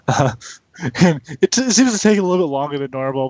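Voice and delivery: ordinary talk, nothing marked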